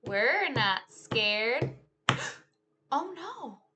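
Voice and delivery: lively, sing-song storytime read; animated picture-book telling; reading to children. Acted. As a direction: act out the story for a class of kids. Teacher retells picture book with moves.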